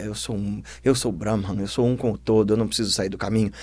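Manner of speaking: low, soft murmur to oneself; serene self-assurance. Acted. Boy meditating as danger nears, soft complacent murmur. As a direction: murmur it, untouched by the commotion.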